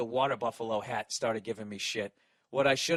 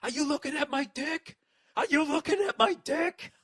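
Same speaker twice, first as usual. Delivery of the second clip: much higher, rising into a louder screech; mock maniac scream; 10 semitones higher, 3 dB louder than usual. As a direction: shriek it like a drunk madman at the urinal. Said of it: "he just starts screaming".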